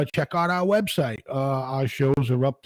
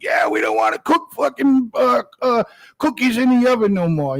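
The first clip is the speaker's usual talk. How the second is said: higher, louder mocking woman's voice; mock hippie woman; 7 semitones higher, 6 dB louder than usual. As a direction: ham up a woman refusing to cook. Mocking hippie women refusing cooking.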